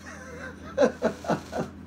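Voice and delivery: squeaky high laugh. Real, not acted. Laughs at own hillbilly voice.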